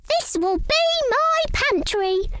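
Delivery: high pleased mouse voice; happy mouse. Acted. Missus Mouse proudly names her pantry, happy and high. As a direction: chirp it out with delight. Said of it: "she said happily".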